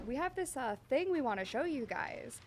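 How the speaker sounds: high, sing-song imitation of colleagues; playful quote, pitch swooping up and down; coy teasing reveal. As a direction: lilt it up high like dangling a secret. Quoting the team's coy reveal.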